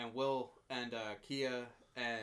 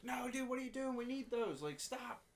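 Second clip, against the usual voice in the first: high-pitched imitation of frantic pleading; mock panicked protest; 11 semitones higher than usual. Co-host imitates Linderman pleading with Freeberg to stop, mock panic.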